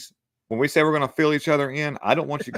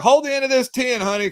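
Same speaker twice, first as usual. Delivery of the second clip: much higher call to his wife across the job; 11 semitones higher, 4 dB louder than usual. Retold or imitated. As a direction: call out to her over the work, pitched way up. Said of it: "I was like".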